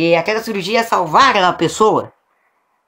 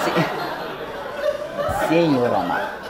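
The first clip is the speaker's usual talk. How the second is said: cracking up mid-word; laughing; 4 semitones lower, 7 dB quieter, much slower than usual. Real, not acted. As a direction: laugh through the words. Laughing at own joke.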